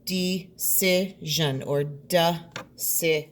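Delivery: "Decision or decision" said slowly, twice; "Decision or decision" at a slow pace; syllables shown. Shown as a demonstration. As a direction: show the beats in "Decision or decision". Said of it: "jean ending".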